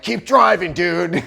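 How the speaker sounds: high, loud irritated keep driving; in a bad mood, waving off a driver; grumpy brush-off. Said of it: "you're like".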